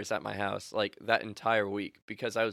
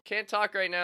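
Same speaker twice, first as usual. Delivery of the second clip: higher-pitched, insistent refusal; insistent brush-off; 8 semitones higher than usual. Retold or imitated. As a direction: press the point to end the call. Repeats brush-off more insistently.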